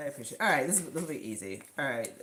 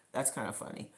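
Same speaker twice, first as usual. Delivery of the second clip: breathy aside, almost whispered; hushed retelling; retold whisper; 6 semitones lower, 8 dB quieter, faster than usual. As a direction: drop to a quick whisper as if embarrassed. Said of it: "and I'm like".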